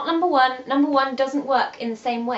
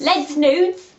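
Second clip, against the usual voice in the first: loud, higher London-English "Les Nudes"; London accent; 4 semitones higher, 7 dB louder than usual. Pretend. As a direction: butcher the French in a London accent. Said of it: "That's really London".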